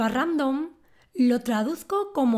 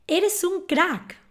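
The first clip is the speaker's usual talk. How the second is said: enthusiastic praise; 7 semitones higher, slower than usual. Shown as a demonstration. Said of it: "Si alguien te dice esto".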